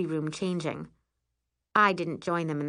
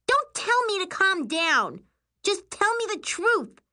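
very high, heated sister's demand; furious outburst; 14 semitones higher, 3 dB louder than usual. A narrator's reading. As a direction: refuse to be calmed. Furious sister refuses to calm down.

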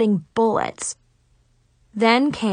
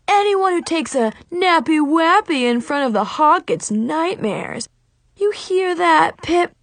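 mocking taunt; 7 semitones higher, 3 dB louder than usual. Sensei taunting student.

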